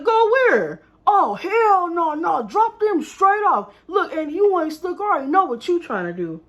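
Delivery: put-on retelling her boyfriend's loud angry outburst. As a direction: blow up at the idea, hell no. Boyfriend angrily refusing the aunt.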